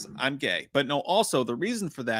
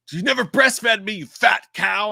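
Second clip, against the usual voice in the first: put-on nasty possessed kid; 8 semitones higher, 7 dB louder than usual. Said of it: "the kid's like".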